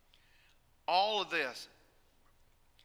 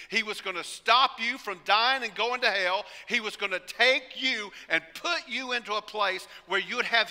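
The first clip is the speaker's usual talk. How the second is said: louder, building preacher's drive; rising sermon fervor; 2 semitones higher, 6 dB louder than usual. Acted. Preacher driving home salvation to the congregation, louder.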